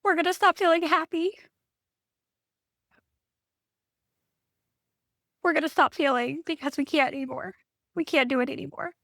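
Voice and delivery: high, tearful voice on the edge of crying; real emotion welling up as she speaks; getting emotional. Real, not acted. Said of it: "I'm gonna get emotional".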